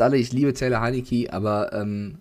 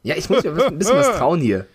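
choking on food; 5 semitones higher, 6 dB louder than usual. Real, not acted. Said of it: "deswegen rede ich so".

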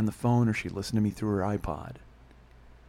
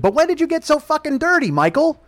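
loud, high-pitched mom rant; mock scolding mother; 9 dB louder than usual. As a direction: imitate an outraged mother telling off her son. Mother scolding son, acted.

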